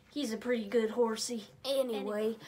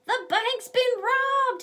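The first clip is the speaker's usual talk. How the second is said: high, loud, slow cry of alarm; shouts; 13 semitones higher, 10 dB louder than usual. A narrator's reading. Banker bursting in on the sheriff, loud alarmed shout. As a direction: shout the news, stretched and high. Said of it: "he shouts".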